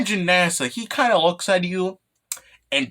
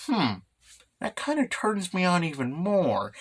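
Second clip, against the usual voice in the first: quiet creepy murmur; 6 dB quieter than usual. Retold or imitated. Nasa muttering about panties.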